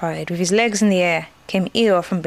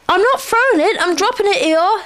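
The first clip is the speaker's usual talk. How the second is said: high, louder, insistent voice; Pooh explaining himself; 12 semitones higher, 5 dB louder than usual. A narrator's reading. Pooh explains the big stone to Eeyore, insistently. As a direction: protest it, pitched well up.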